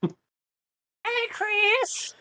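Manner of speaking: high squeaky Family Guy voice; squeaky cartoon neighbor greeting. Imitating a character greeting.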